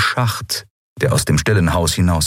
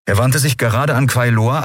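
ordinary delivery

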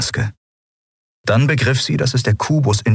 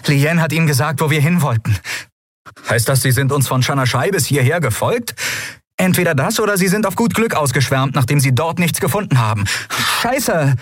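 much higher, fast, rising panic into a curse; agitated young man; 9 semitones higher than usual. Acted. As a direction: rattle it off in alarm. (aufgeregter junger Mann, immer panischer)

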